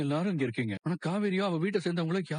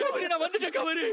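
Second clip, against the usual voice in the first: anguished high call; desperate calling; 10 semitones higher than usual. Acted. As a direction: call to her as if she could answer. Calling for dead wife.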